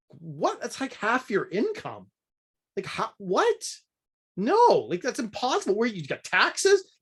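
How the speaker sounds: high-pitched, baffled protest; incredulous reaction. Retold or imitated. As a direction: balk at numbers you can't believe. Replays his incredulous reaction to figures.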